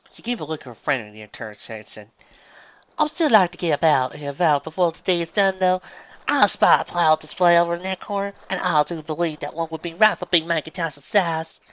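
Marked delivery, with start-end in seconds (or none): 3.0-11.6 high, loud, careful fancy speech; farm mare putting on refinement. Acted. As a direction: strain to sound like a lady.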